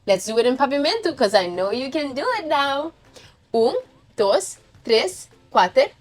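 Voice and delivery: ordinary talk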